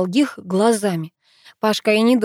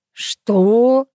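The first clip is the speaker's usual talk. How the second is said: loud, higher-pitched bewilderment; taken aback; 4 semitones higher, 6 dB louder than usual. A narrator's reading. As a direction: blurt it out, totally thrown. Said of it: "растерялся Буравин".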